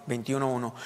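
ordinary talk

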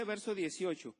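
ordinary talk

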